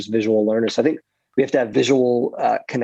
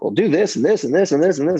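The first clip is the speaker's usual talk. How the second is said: rattling off a list; 5 semitones higher than usual. Retold or imitated. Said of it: "preachers are known for that".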